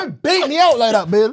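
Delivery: high, put-on whiny protest; high whiny don't bait me out; mock protesting lad. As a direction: play the embarrassed lad pleading. Playing Harry protesting.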